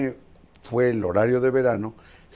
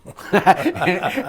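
laughing; 9 semitones higher, 8 dB louder than usual. Real, not acted. Laughing at the minister's claims.

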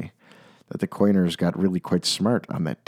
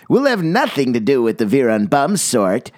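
higher, snooty dismissal; sniffy snort; 5 semitones higher than usual. A narrator's reading. Snobs sniffing at the bum-Veer coiners, snooty. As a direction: turn up your nose. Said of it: "they would sniff and they'd snort".

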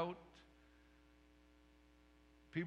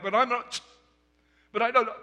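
higher, louder sputtering objection; flustered protest; 7 semitones higher, 9 dB louder, faster than usual. Retold or imitated. Muted Zechariah tries to object to the angel, flustered and higher. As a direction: scramble for words in a panic. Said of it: "Zechariah's like".

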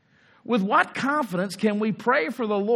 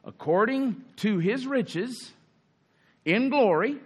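slow, deliberate reading, weighing each word; read slowly; 5 semitones higher, slower than usual. Shown as a demonstration. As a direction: let According to his riches in glory sink in slowly. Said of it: "Let's just read that slowly".

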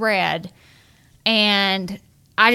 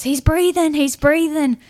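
higher, louder burst of relief; relieved cry; 7 semitones higher, 4 dB louder than usual. Retold or imitated. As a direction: cry out that he's alive. Mother relieved husband is breathing.

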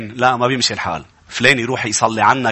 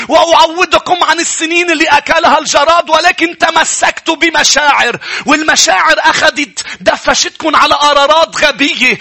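high, loud fiery sermon shouting at the congregation; preaching at full force; 14 semitones higher, 6 dB louder than usual. Acted. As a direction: thunder the warning from the pulpit, louder and higher. (وعظ ناري بصوت عالٍ ومرتفع)

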